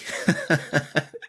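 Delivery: high, airy laugh under the breath; breathless laugh. Real, not acted. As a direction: let out a quick silent laugh at yourself. Laughing at own story.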